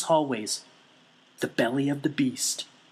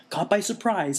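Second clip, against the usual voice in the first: ordinary delivery, nothing marked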